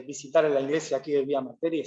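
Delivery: normal talking voice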